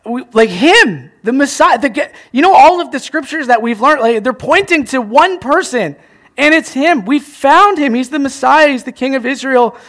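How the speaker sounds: high, loud, breathless excitement; excited retelling. Retold or imitated. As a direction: burst out with the big news to a friend. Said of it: "He's like".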